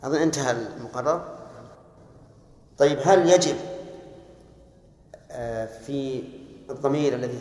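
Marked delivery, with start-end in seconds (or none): none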